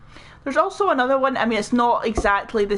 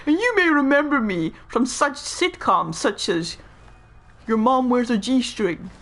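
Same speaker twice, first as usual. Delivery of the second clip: hammed-up presenter plug, higher-pitched; Troy McClure; 4 semitones higher than usual. Actor plugging old sitcoms to viewers, showbiz presenter voice. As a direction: announce the silly title with showbiz polish. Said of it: "Hi, I'm Troy McClure".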